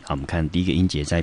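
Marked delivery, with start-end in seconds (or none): none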